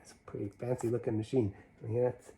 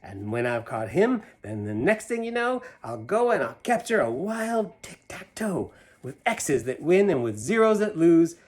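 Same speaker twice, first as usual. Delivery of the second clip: put-on lively read-aloud to kids; animated picture-book reading; story narration; 3 semitones higher, 6 dB louder than usual. A narrator's reading. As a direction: read the rhyme with a bouncy lilt. Reads Seuss poem to children.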